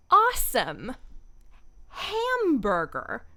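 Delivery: exaggerated American accent, swooping pitch, drawn out; loud, stretched, over-the-top Missouri mom accent; obnoxious American accent. Shown as a demonstration. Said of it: "make it as obnoxious as possible".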